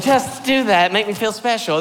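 husband mock-begging his wife for attention, lightly whiny; pleading. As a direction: beg like a needy husband. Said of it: "Like, like".

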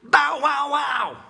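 loud, high mock barking; dog bark imitation. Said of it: "not even a dog will say".

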